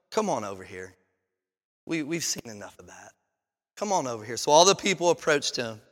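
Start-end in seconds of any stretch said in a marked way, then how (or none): 0.1-4.4 low, soft invitation to come closer; quiet coaxing call. Acted. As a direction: gently beckon the crowd over. Elijah calls the people over.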